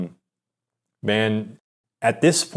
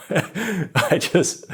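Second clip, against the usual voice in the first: ordinary delivery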